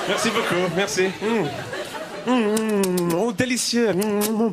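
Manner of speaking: slow, laughing "mm, délicieux"; hammed-up French food bliss; mock French savouring. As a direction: relish the pasty like a gourmet.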